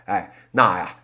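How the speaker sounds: ordinary talk, nothing marked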